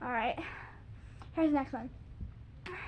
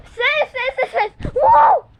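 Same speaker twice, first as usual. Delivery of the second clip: shouting; 9 semitones higher, 13 dB louder, slower than usual. Real, not acted. Yelling at the sister.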